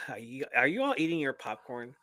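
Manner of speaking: ordinary talk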